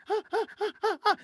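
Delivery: high, quick mock gasps; roller coaster gasps. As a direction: gasp in rising thrill, acting out the climb. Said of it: "that thrill of riding a roller coaster".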